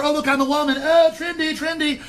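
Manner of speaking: high, prissy mock voice; mock trendy. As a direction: coo like a fussy trendy type.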